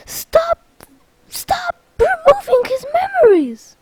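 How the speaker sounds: very high, overwrought shriek; exasperated plea. Acted. Marluxia begging Sora to stop, high exasperated plea. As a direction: squeal it in hammed-up exasperation.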